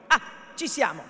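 usual speaking voice

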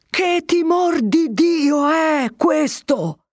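high, very slow bellow from the window; shouting at full lung. A narrator's reading. Captain shouting from the window to the mob. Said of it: "gridava quanto fiato aveva in corpo".

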